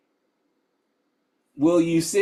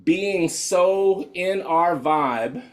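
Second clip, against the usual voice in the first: drawn out slowly; much slower than usual. Acted. Emphasizing the key idea.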